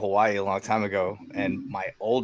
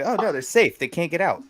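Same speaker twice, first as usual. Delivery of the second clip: higher, breezy mock-casual buddy; casual buddy advice; 8 semitones higher than usual. Buddy breezily telling a new dad, lightly voiced. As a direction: play the buddy as if it's obvious. Mimicking friend's reasoning.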